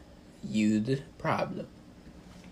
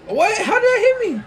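much higher and much louder disbelief; shocked shout; 10 dB louder than usual. Real, not acted. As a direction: yelp in outrage at the hit. Hit unexpectedly in game.

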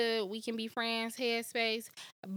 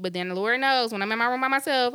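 ordinary delivery

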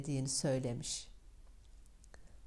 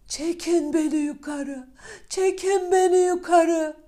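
loud call growing higher and louder; repeated shouting; 13 semitones higher, 10 dB louder than usual. Acted. Youngest son in the well shouting up to his brothers, louder each time. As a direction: call up the well again and again, more desperate. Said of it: "diye defalarca bağırmış".

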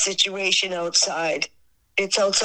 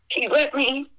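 ordinary delivery

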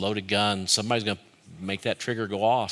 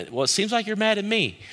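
acted retort; 10 semitones higher, faster than usual. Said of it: "they maybe stop you and say".

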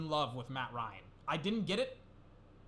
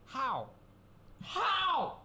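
a much higher, louder cry of disbelief; outraged disbelief; 11 semitones higher, 3 dB louder than usual. Real, not acted. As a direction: yelp it out, completely baffled. Outraged at player ranking.

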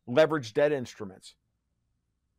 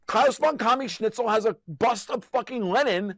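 worked-up rant, voice climbing high; fired-up sarcastic tirade, pitched high; heated rant; 10 semitones higher than usual. Real, not acted. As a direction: rant with rising outrage.

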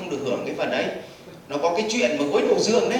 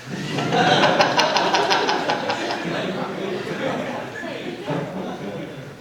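laugh. Real, not acted. Audience laughs at demo.

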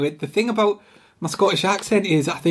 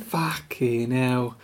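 slow, lower, softer London swearing; Danny Dyer; 5 semitones lower, 5 dB quieter, much slower than usual. Pretend. Friend getting into character, slow put-on London accent. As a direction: drag it out in mock cockney. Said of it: "swear just to get into character".

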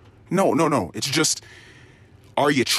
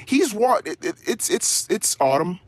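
ordinary talk

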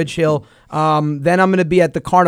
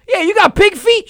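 mock eager ask; 12 semitones higher, 3 dB louder than usual. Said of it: "He goes".

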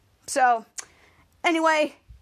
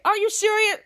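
higher-pitched incredulous questions; mock disbelief; 6 semitones higher than usual. Henry reacting to Schwoz's playing, mock disbelief. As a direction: question it as if exasperated, acted.

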